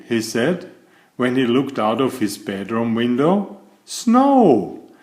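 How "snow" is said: high, delighted exclamation; excited cry. Acted. Mister Silly sees snow from his window, thrilled cry. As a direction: burst out with joy at the window.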